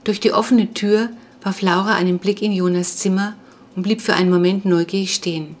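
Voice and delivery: ordinary delivery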